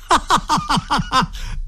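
high-pitched acted guffaw; big laugh. A narrator's reading. Scullery boy sees his cap in the cauldron, laughing. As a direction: burst out in a run of high ha-has. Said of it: "si dette in una grande risata".

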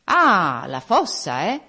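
loud drawn "Ah", questioning rise on "eh"; knowing spirit. A narrator's reading. Spirit throws back the gravedigger's answer, knowing. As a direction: echo his answer with pointed irony. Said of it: "disse lo spirito".

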